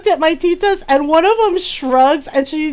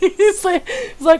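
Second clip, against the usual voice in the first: usual speaking voice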